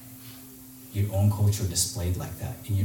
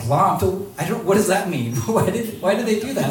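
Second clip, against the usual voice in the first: 1 confused; 8 semitones higher than usual. Retold or imitated. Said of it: "And I was like".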